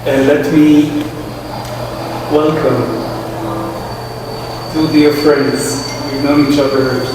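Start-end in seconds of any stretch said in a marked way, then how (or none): none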